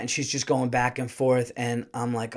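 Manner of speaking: ordinary talk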